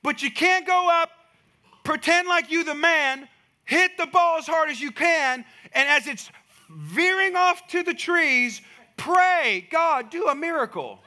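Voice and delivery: fiery preaching punchline; preaching at full force. Acted.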